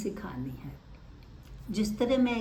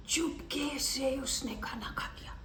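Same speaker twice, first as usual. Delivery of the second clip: sneaky hush; 3 semitones higher, 8 dB quieter than usual. Acted. Storytelling demo, sneaky cat.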